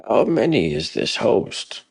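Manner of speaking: a nervous warrior asking; Hrothgar's voice. A narrator's reading. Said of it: "Hrothgar spoke up".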